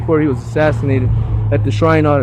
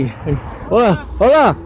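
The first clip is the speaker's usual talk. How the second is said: calling out; 4 semitones higher than usual. Real, not acted. Greeting a passing neighbor.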